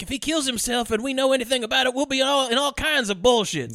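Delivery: high-pitched mock voice of a worried kid. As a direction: imitate a fretting boy, pitched well up. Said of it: "he's just afraid".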